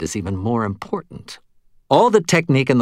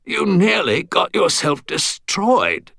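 put-on scolding old Englishman; 9 semitones higher, slower than usual. A narrator's reading. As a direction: sputter and scold like an old soldier. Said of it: "he replied".

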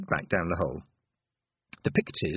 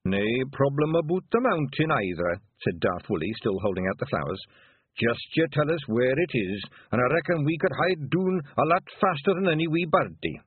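Scottish Feegle voice; 6 semitones higher than usual. Acted. Daft Woolly Scots voice.

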